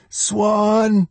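high-pitched frantic mother's call; shouted. A narrator's reading. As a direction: call out high for a missing child. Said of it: "Darlene shouted".

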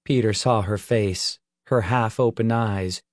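ordinary delivery, nothing marked

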